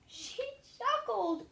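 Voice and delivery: high, loud, slow wail; the king wails the accusation; king cries out. A narrator's reading. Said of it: "cried the king".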